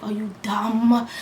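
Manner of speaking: whispered disbelief, slow; hushed, can't believe it; stunned whisper. Retold or imitated. Spat on by a stranger on the train, a stunned whisper. Retold reaction to being spit on.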